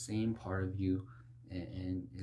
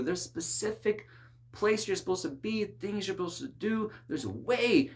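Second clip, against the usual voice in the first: higher, louder preaching, pressing each point; fervent preaching; 7 semitones higher, 5 dB louder than usual. Real, not acted. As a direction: drive home the calling from the pulpit. Preaching with rising force.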